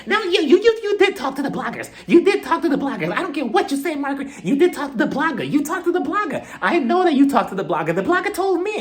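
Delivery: hammed-up hothead accusing Margaret; mock hothead Teresa. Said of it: "she's just becoming a hothead".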